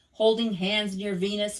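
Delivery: ordinary delivery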